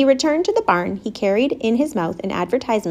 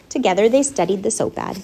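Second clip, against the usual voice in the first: usual speaking voice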